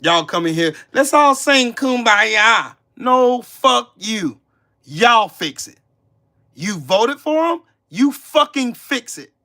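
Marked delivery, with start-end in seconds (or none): 0.9-2.8 higher, louder, slow sing-song mockery; mocking. Mocking calls for harmony. 3.0-9.3 angry shout. Real, not acted. Streamer yells at Trump voters to fix it themselves, furious.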